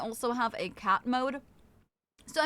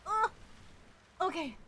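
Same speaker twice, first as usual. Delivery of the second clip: ordinary talk, nothing marked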